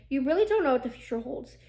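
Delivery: normal talking voice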